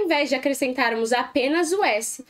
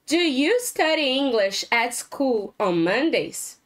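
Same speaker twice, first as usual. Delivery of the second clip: slow model question; slower than usual. Shown as a demonstration.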